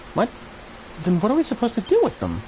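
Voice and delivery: put-on high, startled young hero protesting; a touch of a put-out young hero; indignant hero. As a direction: push back in disbelief. Voices the hero's reply.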